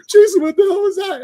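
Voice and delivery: high, indignant shriek of the shut-out coworker. Retold or imitated. Coworker shut out by the door, shrieking in outrage. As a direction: squeal it like she got the door slammed on her.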